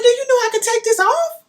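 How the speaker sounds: high, loud, indignant challenge; outraged retort. Retold or imitated. Snapping back at the rep about her weave, high and indignant. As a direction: throw it back at her, incredulous.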